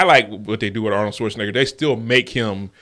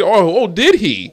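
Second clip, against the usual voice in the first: mock mom, surprised; 9 semitones higher, 6 dB louder, slower than usual.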